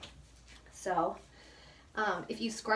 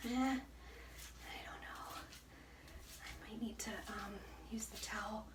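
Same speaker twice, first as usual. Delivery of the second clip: much softer whispered doubt; hushed self-talk; unsure whisper; 12 dB quieter than usual. Real, not acted. Unsure muttering.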